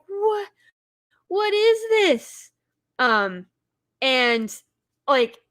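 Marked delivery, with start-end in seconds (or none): 0.1-2.5 high, stunned what is this; baffled squeak of surprise; shocked disbelief. Retold or imitated. Watching Hans switch to a cowboy accent, high disbelief. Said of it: "I was like".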